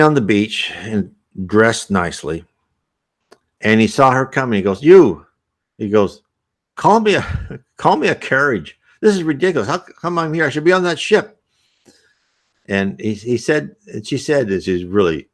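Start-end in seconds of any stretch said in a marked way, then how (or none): 4.8-5.3 higher, louder imperious hail; calling out. Retold or imitated. Stranded passenger hails the woman on the beach, calling out. 6.8-11.4 high-pitched, indignant ordering about; acting superior. Stranded passenger orders the woman to fetch a carriage, acting superior. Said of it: "treated me like he was my superior".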